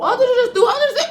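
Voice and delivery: high, loud shocked cry; shocked shout. Real, not acted. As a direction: shriek it in surprise.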